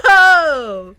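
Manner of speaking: loud, high gasp into a cry of "oh"; scandalized shriek of surprise; shocked gasp. Real, not acted. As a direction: gasp at a huge reveal. Reacts to confession reveal.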